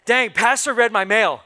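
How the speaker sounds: called out loud and bold. Shown as a demonstration. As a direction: belt it to the congregation. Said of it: "Let me do this again".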